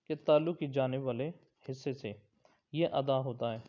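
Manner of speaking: ordinary talk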